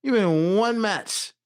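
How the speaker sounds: slow, emphatic bragging comeback; imagined boastful retort. Retold or imitated. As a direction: lay out each credential slowly and pointedly.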